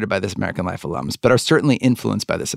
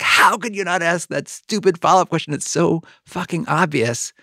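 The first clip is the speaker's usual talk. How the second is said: mock-furious inner rant, higher and slowing; inner rage; 7 semitones higher than usual. Boss raging inwardly at a trainee, mock-furious. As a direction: fume silently at the trainee, pitched up. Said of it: "I would just be so filled with rage".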